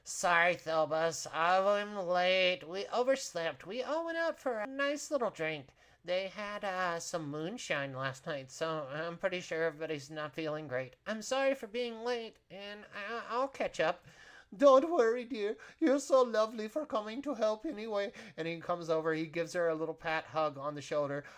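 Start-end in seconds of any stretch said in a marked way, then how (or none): 0.0-14.1 higher-pitched, hungover helper making excuses; apologetic late kitchen girl. Acted. Late helper explaining to the boss, apologetic higher voice. 14.6-18.1 put-on high-pitched, fussing, forgiving old boss; kindly boss. Boss forgiving his late helper, high kindly voice.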